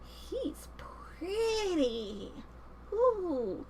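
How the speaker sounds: dreamy, breathy-light crush sigh; smitten girl. Acted. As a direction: swoon over the handsome man. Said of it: "She's smitten".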